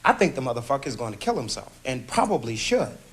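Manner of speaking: high-pitched, rapid angry retort. Acted. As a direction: let the anger push your pitch up. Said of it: "I'm sensing anger".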